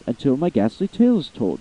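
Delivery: plain reading voice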